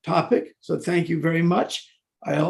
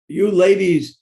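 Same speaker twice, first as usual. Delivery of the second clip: loud start of mock praise; sarcastic flattery; 3 semitones higher, 7 dB louder, slower than usual. Man replying to a lady's remark, sarcastic flattery. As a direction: begin a pointed, sarcastic compliment. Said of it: "That would be sarcasm".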